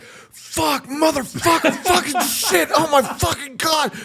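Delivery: very high, loud swearing in pain; student on the gym floor with a rolled ankle, screaming; pain yell. Retold or imitated. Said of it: "and I'm just like".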